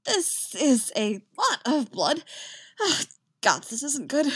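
high, wavering distress, dragged out at first; wounded and starting to panic; shocked dismay. A narrator's reading. Wounded bard panicking at his blood.

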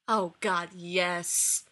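fervent, relieved agreement. A narrator's reading. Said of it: "Lorne agreed fervently".

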